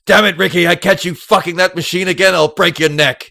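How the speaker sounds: loud, high hammed-up scolding; mock angry boss. Police chief scolding Ricky at his desk, loud mock fury. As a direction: bark at Ricky like a furious boss. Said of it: "walks up to him and goes".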